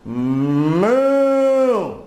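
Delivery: long, rising imitated moo; cow moo. Cow trying to scare the goat, long imitated mooing. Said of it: "Cow mooed at Goat".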